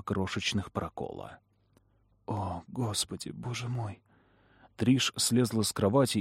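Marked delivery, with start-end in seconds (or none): 2.3-4.0 hushed horror. A narrator's reading. Discovers wounds on baby.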